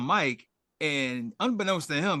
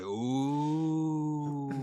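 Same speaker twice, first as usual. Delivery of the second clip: long drawn-out ooh; drawn-out teasing ooh; mock onlookers' ooh; 4 dB quieter than usual. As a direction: imitate a crowd scenting trouble. Imitating the onlookers' reaction.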